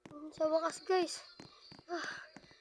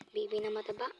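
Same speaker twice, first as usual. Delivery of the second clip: whispering; 9 semitones lower, 4 dB quieter than usual. Real, not acted. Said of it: "sneaking prank vlog".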